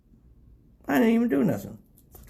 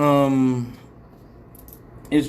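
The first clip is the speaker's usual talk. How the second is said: ordinary talk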